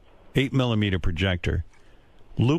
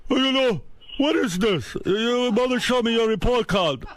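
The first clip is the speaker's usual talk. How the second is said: high-pitched mock dad rant; imitated yelling father; 11 semitones higher than usual. Father confronts son over report card, mock yelling. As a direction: scold like an exasperated dad, voice pushed high. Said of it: "he just fucking yelled at me".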